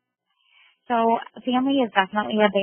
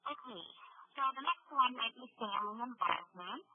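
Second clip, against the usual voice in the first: faint, cutting-out phone line; crackly cordless phone; 2 semitones higher, 11 dB quieter than usual. Real, not acted. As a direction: voice fading away on a bad phone. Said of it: "That's worse".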